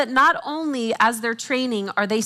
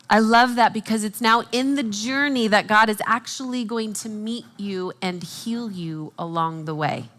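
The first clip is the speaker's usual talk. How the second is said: ordinary talk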